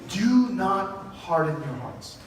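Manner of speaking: "do not harden your hearts" drawn out slowly, weight on "do"; slow, deliberate reading. Shown as a demonstration. Preacher reading the psalm slowly to his congregation. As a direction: let "do" lead, then ease each word out slowly. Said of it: "it starts off with".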